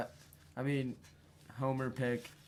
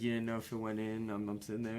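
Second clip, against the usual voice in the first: ordinary talk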